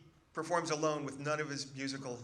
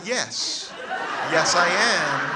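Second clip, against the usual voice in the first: exasperated; 3 semitones higher, 12 dB louder, much slower than usual. Retold or imitated. Exasperated reply to mother.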